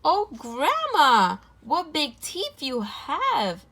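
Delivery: put-on high, loud, slow frightened girl; a little girl seeing the wolf's teeth; gasped. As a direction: gasp it out in alarm. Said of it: "gasped the little girl".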